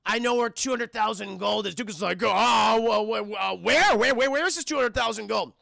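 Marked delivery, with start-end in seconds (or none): 2.2-5.5 eager stammer. Retold or imitated. Tuco excited about gold.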